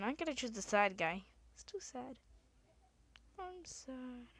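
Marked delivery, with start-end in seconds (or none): 1.5-4.3 softer, mock-mopey voice; mock sad. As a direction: pout it out softly. Choosing the sad character.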